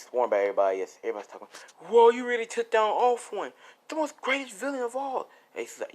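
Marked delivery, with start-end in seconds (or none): 1.9-5.3 higher, louder, amazed fan voice; awed students. Acted. Classmates praising Izuku for beating the villain, awed and excited. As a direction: gush like a starstruck classmate.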